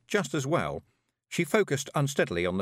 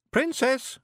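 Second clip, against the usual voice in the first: very high little voice; wee Scottish prompter; 4 dB louder than usual. A narrator's reading. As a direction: pipe up with the missing word. Voices Billy prompting Rob.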